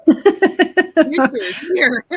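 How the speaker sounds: slow grand slogan voice; mock announcer. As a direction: intone it like a trailer. Mock grand slogan, then laughs.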